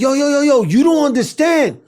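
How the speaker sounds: high, loud, hammed-up alarm; retold frantic warning. Warning friends he is not human, frantic mock yelling. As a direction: warn them off in mock panic.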